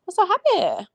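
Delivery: high, sing-song mock gush; mocking sugary gush. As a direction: coo it high like a fake happy man. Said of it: "was like".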